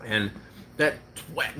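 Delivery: ordinary talk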